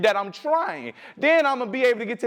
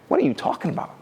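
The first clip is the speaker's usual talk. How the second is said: confused whisper; 4 semitones lower, faster than usual. Retold or imitated.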